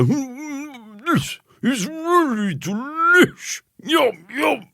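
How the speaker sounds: very high, slow, talking through a mouthful; mouth full. A narrator's reading. Cousin chewing the boy's snack, mouth full. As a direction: say it while chewing.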